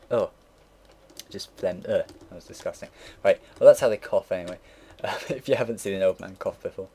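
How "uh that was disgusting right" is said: ordinary delivery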